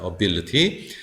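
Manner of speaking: "ability" as uh-BIL-uh-tee; weak schwa opening "ability", stress on BIL; reduced first vowel. Shown as a demonstration. Teacher modelling the word, weak A and stressed BIL. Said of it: "야금으로 갑니다".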